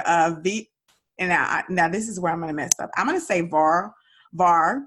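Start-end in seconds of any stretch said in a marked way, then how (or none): 3.4-4.8 "Var" in a Dallas Southern accent. Real, not acted. Host warns she'll mess up guest's brand name, Southern Dallas accent. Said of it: "that's my Southern Dallas accent".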